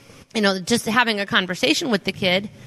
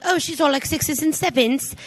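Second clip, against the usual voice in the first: British accent impression; 6 semitones higher than usual. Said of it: "Austin Powers".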